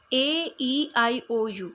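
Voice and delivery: ordinary talk, nothing marked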